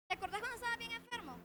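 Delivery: quick mock reproach.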